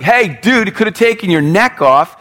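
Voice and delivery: mock-casual high taunt; casual dude voice. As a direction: toss it off like a cocky buddy. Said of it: "it wasn't kinda like".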